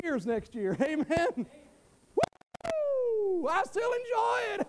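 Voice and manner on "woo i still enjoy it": joyful whoop. Real, not acted. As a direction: burst out with real joy. Preacher erupts with joy.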